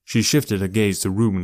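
usual speaking voice

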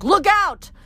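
high, loud warning cry; warning shout. A narrator's reading. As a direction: yell a warning.

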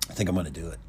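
low-key, softly spoken confession of intent; soft, subdued admission; quiet resolve. Retold or imitated. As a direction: say it quietly, like confiding a decision. Coworker confides his robbery decision.

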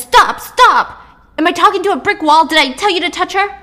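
high, very loud exasperated yelling; furious shouting. Acted. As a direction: explode at a hostage who disobeyed. Attacker yells at hostage to stop.

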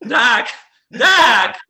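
high, loud quacking yelp; shouted one-word Fox impression; Michael J. Fox. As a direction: squawk like a trodden-on duck. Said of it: "like, stepping on a duck".

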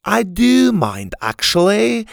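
high, drawn-out crotchety objection. A narrator's reading. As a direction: object like a cranky old man. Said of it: "the crotchety man said".

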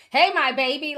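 high, loud mock of a doting mother; hey my baby in a loud, high mocking voice; mocking the mom's phrase. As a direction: parody the cloying greeting. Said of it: "I just feel like a".

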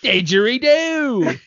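high, slow, drawn-out mock Australian cry; mock Australian accent. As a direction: call it out in a hammy Australian accent. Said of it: "if that was her accent".

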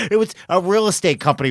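ordinary talk, nothing marked

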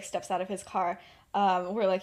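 usual speaking voice

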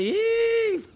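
a high, thrilled yelp of joy; excited yelp. Retold or imitated. Friend sees the billboard and yelps in excitement. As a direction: squeal it like you just saw your dream come true.

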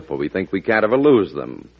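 ordinary delivery

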